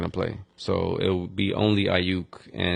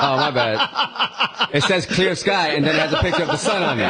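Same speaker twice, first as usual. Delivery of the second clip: helpless laughter through the words, much higher pitched; laughing; 10 semitones higher, 3 dB louder than usual. Real, not acted. Host owning a silly night-game forecast slip, laughing. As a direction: crack up while explaining yourself.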